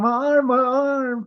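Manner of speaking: high, drawn-out imitation wail; mock pained scream. Kenny on the ground with a broken arm, mock screaming. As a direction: wail it slowly, mimicking someone hurt. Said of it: "'cause Kenny just screamed".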